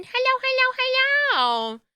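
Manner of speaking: very high, sing-song hellos; excited hellos. Real, not acted. Streamer greeting chat, very high and excited. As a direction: call out hello brightly.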